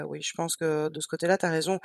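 normal talking voice

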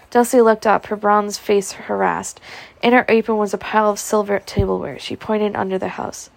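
usual speaking voice

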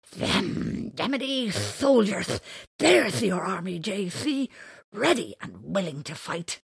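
loud, rallying; rousing rally. Acted. Big buck rabbit rallies J C, loud rousing. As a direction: fire them up for the fight.

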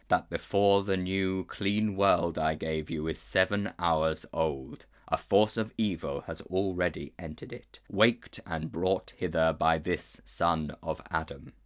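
a solemn lion king speaking down; lion's voice. Acted. Aslan questions the boy before his counsellors, slow and grave.

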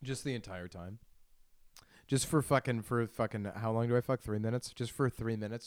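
ordinary delivery

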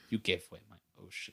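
low, very soft, quick grumble; hushed mock complaint; sulky Poseidon. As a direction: grumble it under your breath. Poseidon voiced sulking.

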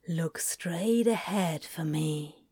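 hushed and slow, almost whispering; soft slow murmur. A narrator's reading. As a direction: murmur it softly and slowly, close. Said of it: "murmured softly and slowly, his voice barely more than a whisper".

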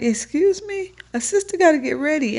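high, indignant "excuse me" with real attitude; sassy indignation. Retold or imitated. To a date who asked her out too late, sassy indignation.

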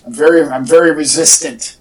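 ordinary talk

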